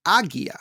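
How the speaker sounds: AGIA with a hard G, as in "go"; hard-G pronunciation. Shown as a demonstration. Trying out a correspondent's version of the name, Agia with a hard G. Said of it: "both pronounced it".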